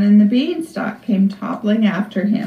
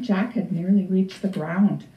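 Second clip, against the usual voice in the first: ordinary delivery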